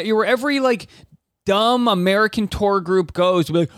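normal talking voice

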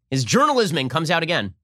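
ordinary talk, nothing marked

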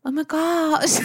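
high hammed-up Jamaican accent, laughing; a silly Jamaican voice cracking into laughter. Retelling a friend's reaction in a hammed-up Jamaican accent. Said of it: "I'm like Joey with the Jamaican accent right now".

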